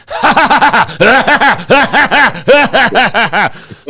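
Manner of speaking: high, rapid cackling bursts; put-on high-pitched hysterical laugh; imitated laughing fit. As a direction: mimic someone cracking up at his phone. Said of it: "he's laughing, going like".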